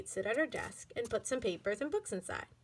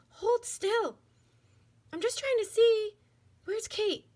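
high, small doll voice; little doll voice; 11 semitones higher, 4 dB louder, slower than usual. A narrator's reading. Dolls hiding in a box, high small voices. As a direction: voice a tiny doll eager to see.

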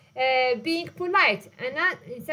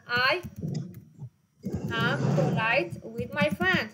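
slow dictation; much slower than usual. Shown as a demonstration. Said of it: "teacher models sentence slowly".

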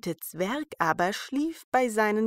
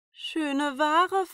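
put-on high calling old peddler; peddler woman; 8 semitones higher than usual. A narrator's reading. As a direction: hawk wares at the door. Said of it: "klopfte an die Türe und rief".

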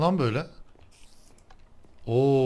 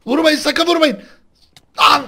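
high, loud pleading yell; screaming don't hit; frantic shout; 13 dB louder than usual. Real, not acted. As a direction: yell at them not to hit. Begging others not to hit.

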